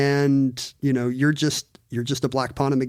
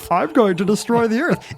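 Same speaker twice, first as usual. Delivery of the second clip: cartoon villain voice; 6 semitones higher than usual. Shown as a demonstration. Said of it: "a guy doing a put-on voice".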